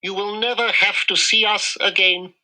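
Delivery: a small, worried mouse doctor; voiced mouse dentist. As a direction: play the little dentist, doubtful.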